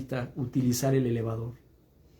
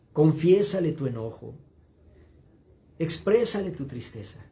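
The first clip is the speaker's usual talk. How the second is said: usual speaking voice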